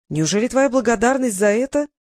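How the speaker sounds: plain reading voice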